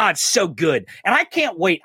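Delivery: ordinary talk, nothing marked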